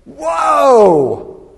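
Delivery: long, high, loud whoa of wonder; amazed shout. Retold or imitated. Boy sees the magician's box empty, acted amazed shout. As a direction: re-enact a kid's awe, drawn out and loud.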